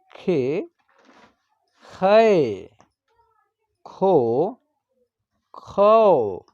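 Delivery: each syllable drawn out alone, with long pauses between; barakhadi recitation. Shown as a demonstration. Teacher reciting Hindi syllables to students as a slow classroom chant. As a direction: chant the letters slowly for children to repeat.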